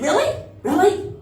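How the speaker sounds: put-on doubt the kid like a stern mother; mother scoffing at her twelve-year-old; skeptical mom. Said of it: "My mom said".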